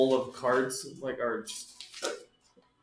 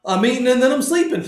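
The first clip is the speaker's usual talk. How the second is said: loud; 9 semitones higher, 11 dB louder than usual. Real, not acted. Loud announcement to table.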